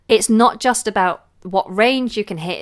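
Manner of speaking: usual speaking voice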